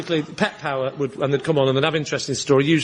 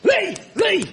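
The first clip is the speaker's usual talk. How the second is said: calling out. Retold or imitated. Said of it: "bloke banging on the door going".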